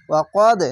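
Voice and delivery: ওয়া কদ with the final দ bounced out hard; qalqala bounce. Shown as a demonstration. Teacher showing qalqala on ওয়া কদ with a jolt. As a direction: hit the দ of কদ with a jolt. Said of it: "কলকলা ধাক্কা মেরে হবে".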